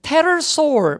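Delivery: "테러소울"을 p 없이 테로 시작; "테러소울"의 첫소리를 푸 없이 힘주어 시범. Shown as a demonstration. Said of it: "묵음이 돼야 됩니다". ("테러소울", 묵음 p로 바로 t부터)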